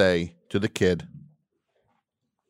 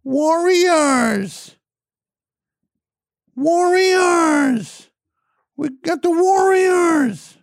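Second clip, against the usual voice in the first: put-on shrill high kid's scream, much louder; kid yelling Warriors; 4 dB louder, much slower than usual. Bratty kid screaming at the farmer's market, high and loud. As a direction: shriek it like a bratty kid trolling the crowd. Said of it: "some frickin' kid there going".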